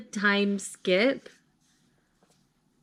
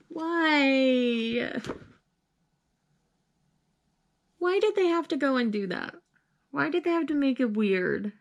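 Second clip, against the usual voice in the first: upset; 3 semitones higher than usual. Real, not acted. Reader asking why the story went weird, upset whine. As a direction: whine it as a helpless protest. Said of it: "I'm so sad".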